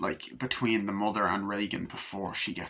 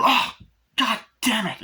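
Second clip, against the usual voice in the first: imitating a furious shout, much higher and louder; higher, louder mock cry of frustration; mock angry outburst; 12 semitones higher, 6 dB louder than usual. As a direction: blurt it out like a fed-up mother. Mrs McNeil's outburst retold from film.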